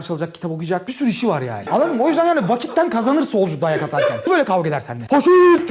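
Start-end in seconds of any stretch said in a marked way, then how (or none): 5.1-5.7 loud, high shout of the insult; mock shout. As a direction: yell it like a street fighter. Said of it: "böyle saldırır sana".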